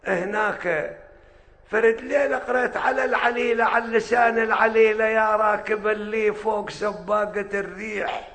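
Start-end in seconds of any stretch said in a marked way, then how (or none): 5.0-8.3 Bedouin accent. Shown as a demonstration. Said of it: "لهجة بدوية".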